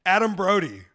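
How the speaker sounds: ordinary talk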